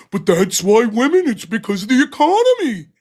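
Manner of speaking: lightly hammed-up parody of a man's argument; stupid mocking voice. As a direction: make fun of his argument in a dumb voice. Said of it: "You make this stupid voice".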